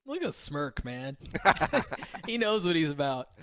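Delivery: laughing. Real, not acted. Caster laughing at player.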